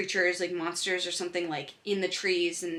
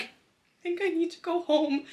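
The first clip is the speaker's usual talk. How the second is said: high, slow, mock-whiny I think I need to go home; mock whiny plea; 10 semitones higher than usual. Student telling an awkward teacher she must go home, mock whiny and high. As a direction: play up the fragile, tearful student. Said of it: "he did not know how to handle a woman's emotions".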